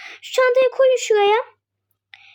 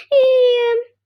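normal talking voice

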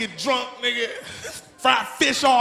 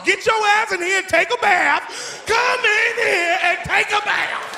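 higher, slow shouted order; mean grandma; 8 semitones higher, slower than usual. Retold or imitated. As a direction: boss the kid into the tub. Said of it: "Soon as you get over her house".

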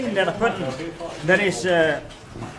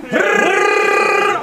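rolled-r drumroll; 10 semitones higher, 6 dB louder than usual. Acted. Imitated drumroll before announcement.

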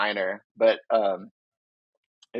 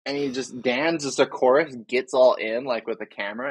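ordinary delivery

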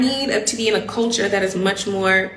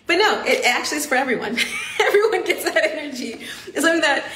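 higher voice with laughter breaking through; amused, laughing; 6 semitones higher, faster than usual. Real, not acted. Speaker admitting everyone gets that treatment, laughing. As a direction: laugh while talking. Amused correction of her first reaction.

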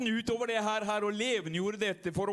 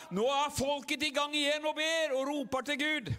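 full-force preaching; 4 semitones higher than usual. Acted. Pastor firing up the revival meeting, full-force preaching. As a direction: lift the crowd to its feet. Preaching resumes after a dip.

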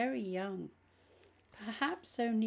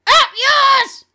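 high, full-volume demonic yell; defiant demon shout; 25 dB louder than usual. A narrator's reading. Demon refusing to repeat the prayer, defiant shout. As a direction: spit defiance at the top of your voice.

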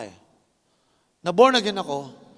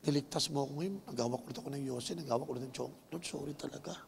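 tearful prayer; 11 dB quieter, faster than usual. Retold or imitated. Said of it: "naiyak ako, sabi ko".

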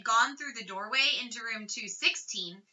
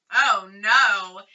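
dismayed; 3 semitones lower, 10 dB louder than usual. A narrator's reading. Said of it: "echoed Ralph's thoughts".

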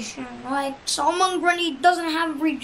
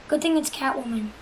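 ordinary delivery

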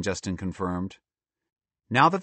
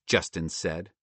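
ordinary delivery, nothing marked

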